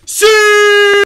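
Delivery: excited yell; shout. Real, not acted. Shouting at stranger.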